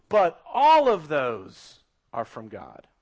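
slow, loud "all of those", then dropping; proclaimed to everybody. Acted. Book of Job's conclusion to everybody, slow proclamation. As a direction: hammer the opening, let the ending fall. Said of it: "screams out to everybody".

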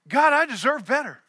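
loud, high, aggrieved cry to heaven; indignant outburst. Retold or imitated. A frustrated man in a cave complaining loudly to God. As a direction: demand what you think you're owed, loudly.

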